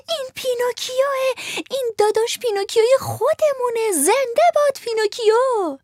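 high, excited puppet shout; a crowd of puppets cheering. Acted. All the puppets shout Pinocchio's name, high and excited. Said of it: "فریاد کشیدند".

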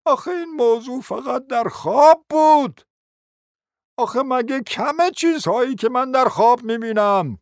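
high-pitched pleading explanation; plaintive protest. Acted. Prince insisting to the ladies it was a dream, protesting.

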